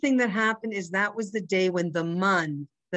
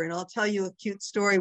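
usual speaking voice

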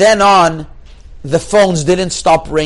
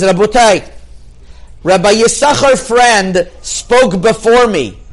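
slow, raised public-address delivery; imitating speech opening; 4 semitones higher than usual. Keynote rabbi addressing the stadium crowd, slow proclamation. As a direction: proclaim it slowly, higher in pitch, as a retold speech. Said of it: "It's how he said it".

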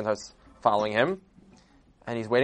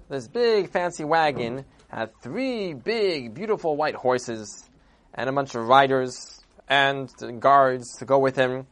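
plain reading voice

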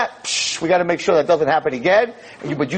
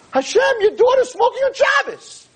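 crying out. Retold or imitated. Rabbi crying out to Hashem.

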